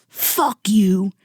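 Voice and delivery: hammed-up furious outburst in an altered voice; exaggerated angry rant, not really shouted; mock angry cursing. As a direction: pretend to lay into someone. Acts out hypothetical cursing at shopkeeper.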